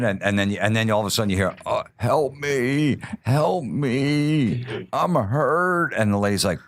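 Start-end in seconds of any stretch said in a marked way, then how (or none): 1.6-5.9 much higher, slow, pained call for help; injured man moaning. Acted. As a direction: groan it like a man hurt on the road. Injured man voiced in crank call.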